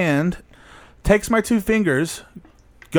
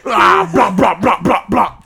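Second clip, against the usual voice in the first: imitating gunfire; 5 dB louder, slower than usual. Imitating gunshots.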